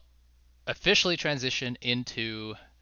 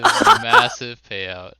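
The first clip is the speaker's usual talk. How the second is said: slow, laughing through the words; 8 dB louder, much slower than usual. Real, not acted. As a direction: laugh it out slowly. Host laughs while speaking.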